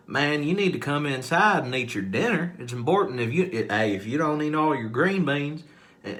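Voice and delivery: put-on Southern parent calling a kid in to dinner; Southern relative. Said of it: "kinda Southern".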